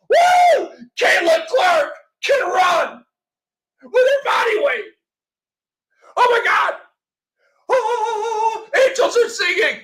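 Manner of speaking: hammed-up joy at the tiniest progress; sarcastic mock celebration. Host mocking the injury update, sarcastic cheering.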